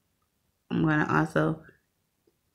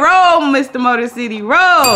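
higher and louder, egging him on; loud urging cheer; 5 semitones higher, 7 dB louder than usual. Real, not acted. Urging Mister Motor City to keep scratching, loud cheer. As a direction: roll it out like a hype man.

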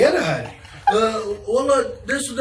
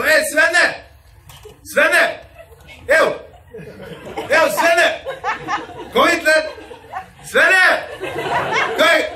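high, loud calling across a yard; 10 semitones higher, 5 dB louder than usual. Retold or imitated. Relatives calling the Swedish family over to eat, loud hollering. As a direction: holler to the neighbours to come over.

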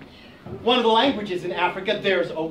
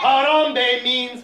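slow call-and-response prompt; 3 semitones higher, much slower than usual. Shown as a demonstration. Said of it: "could you yell back".

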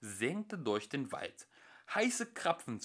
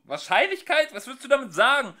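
high, loud, fast yelled question; shouting; 12 semitones higher, 13 dB louder, faster than usual. Acted. Arthur yelling back at Ford, loud and confused.

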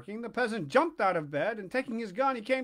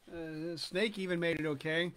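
usual speaking voice

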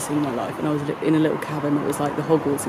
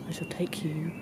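whisper; 9 dB quieter than usual. Real, not acted. Whispered aside to camera.

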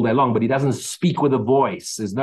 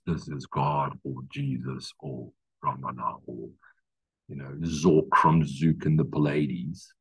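much lower, much softer ghostly voice, drawn out; mock spooky voice; 9 semitones lower, 7 dB quieter, slower than usual. Preacher mocking voices claiming to be God, eerie mock intone. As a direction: intone it like a cheesy oracle.

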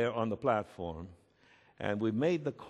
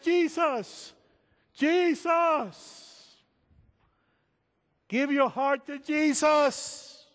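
high, loud, drawn-out shout, slowing at the end; scream; 6 dB louder, much slower than usual. Retold or imitated. Pastor shouting after the man being pulled from him, loud urgent scream. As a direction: scream the name over the crowd, stretching it out. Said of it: "I had to scream".

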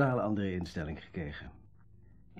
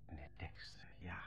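Meneer Dexter whispered much softer, no voice; stunned whisper; 3 semitones lower, 19 dB quieter than usual. Acted. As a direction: barely breathe Meneer Dexter, caught out. Stunned on learning Dexter read it.

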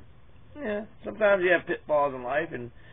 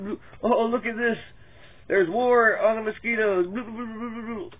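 mock whining; 6 semitones higher than usual. Mocking an imagined complainer online in a higher, whiny voice. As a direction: sneer at the complainer as you parrot him.

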